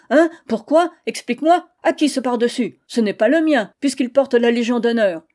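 husband bewildered and frantic over the coat; panicked questioning. Acted. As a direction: demand answers in a panic. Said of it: "dans une sorte d'affolement".

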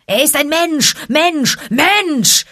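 angry pack snarl. A narrator's reading. Wolf pack snarling at Akela, high and furious. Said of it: "knurrte wütend das Rudel".

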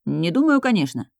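ordinary delivery

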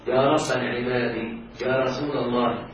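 slow, deliberate recital of examples; slow example reading. Shown as a demonstration. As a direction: lay out each phrase slowly for the class. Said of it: "أقول".

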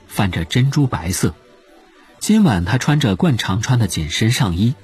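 plain reading voice

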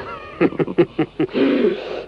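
high hearty laugh; nostalgic laugh. Acted. Old lieutenant laughing about his glory days.

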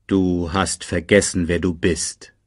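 lightly voiced solemn ghost father; a hint of a grave fatherly spirit; Mufasa. A narrator's reading. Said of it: "sagte die Stimme Mufasas". (getragene Geisterstimme des Vaters)

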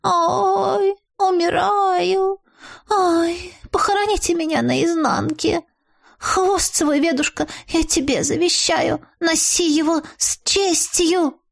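high, slow, wailing death-bed voice; melodramatic dying moan. A narrator's reading. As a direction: wail like a drama queen dying. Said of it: "снова застонала от боли".

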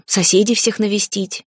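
usual speaking voice

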